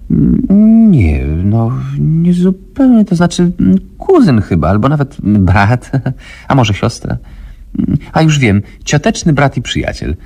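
high, halting, stumbling woman's voice; zmieszana, wysoka, plącząca się kobieta; flustered woman. A narrator's reading. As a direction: fumble for words, high and hesitant. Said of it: "plątała się kobieta".

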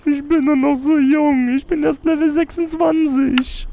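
high, slow hammed-up lament; mock wailing. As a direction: wail it like a doomed hero. Dramatic plea while fleeing.

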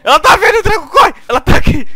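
high panicked shouts at a partner; panicked yelling. Real, not acted. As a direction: yell in real fright, high and loud. Monster chasing in horror game.

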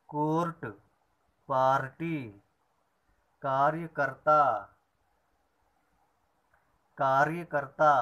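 loud clear dictation of words; slow classroom recitation; word list drill. Shown as a demonstration. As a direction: read each word out for the class to repeat. Teacher reads word list aloud.

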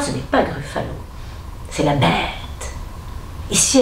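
usual speaking voice

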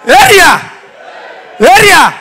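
put-on loud rising call of area boys; shouted street call. Comedian calling the area boys with a loud shout. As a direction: bellow it out like a tout.

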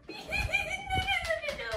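high shouted no no; panicked no. Real, not acted. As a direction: cry out in panic. Panicked protest.

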